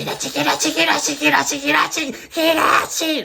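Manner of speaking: high, loud shriek of Hiroshi, Hiroshi; screaming Hiroshi after the whispers; shrieking the name. Retold or imitated. Ghost woman calling the name.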